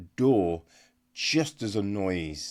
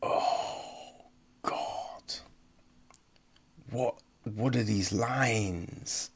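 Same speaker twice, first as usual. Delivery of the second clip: slow, breathless whisper of disbelief; exasperated whisper; 6 dB quieter, slower than usual. Retold or imitated. Reviewer to viewers about bad dialogue, whispered in disbelief. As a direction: mutter it under your breath, appalled. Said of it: "And you're just like".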